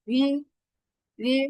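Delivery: রি said as r blended into i; রি with r and i merged, not plain ri; রি, a mixed r-i sound; pronunciation demo. Shown as a demonstration. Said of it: "direct রি বলবো না".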